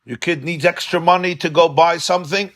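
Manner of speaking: ordinary talk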